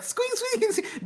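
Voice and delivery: very high rubbery squeaks; squeaky toy voice. Retold or imitated. Ragnar imitated squeaking again.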